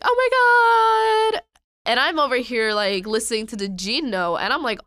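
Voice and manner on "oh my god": high, loud imitation fangirl squeal; drawn-out, high-pitched mock scream; mock fan scream. Imitating fans reacting to the G note, mock scream. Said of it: "everybody would scream and be like".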